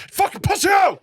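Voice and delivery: high loud laughing mock shout; mock yell. Comic echoing the heckler's insult, mock shout. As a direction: throw the heckle back for a laugh. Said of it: "echoes heckler line".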